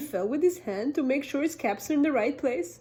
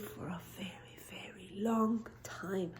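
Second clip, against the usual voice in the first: sleepy whisper; 3 semitones lower, 11 dB quieter than usual. A narrator's reading. Storyteller whispering as the peddler falls asleep. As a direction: lull them off to sleep.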